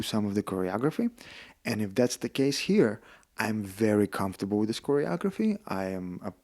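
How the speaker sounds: soft, low, careful request; very polite. Retold or imitated. Actor approaches the director to offer his skills, softly polite. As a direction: tread gently, as if asking a favour. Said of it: "unless you're very polite".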